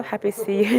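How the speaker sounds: usual speaking voice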